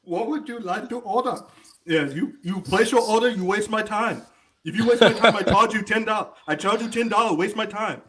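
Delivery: high-pitched mock Chinese order-taker; mock Chinese accent. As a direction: scold the caller in a put-on Chinese accent. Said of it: "Heng Wo Chinese restaurant".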